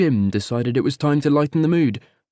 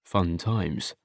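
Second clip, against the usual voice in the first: plain reading voice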